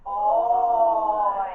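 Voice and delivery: "Oi" drawn out long, loud and steady; stretched out. Shown as a demonstration. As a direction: stretch "Oi" sideways in a loud held note. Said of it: "Let's do it horizontally".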